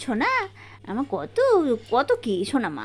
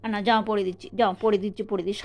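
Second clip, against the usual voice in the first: ordinary talk, nothing marked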